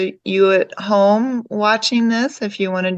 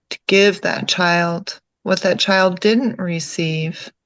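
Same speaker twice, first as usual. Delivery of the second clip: calm meditation guide, lower than chatting, with long pauses; guided meditation; 4 semitones lower than usual. Real, not acted. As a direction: guide them gently inward, leaving long silences between phrases.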